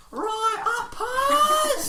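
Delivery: teacher shouting. Retold or imitated. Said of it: "She was like".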